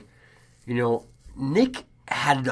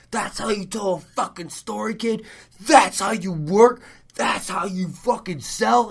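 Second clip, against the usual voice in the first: higher, hyped-up promo shout; wrestling promo; 9 semitones higher, 3 dB louder than usual. Retold or imitated. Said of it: "came out and cut a promo and goes".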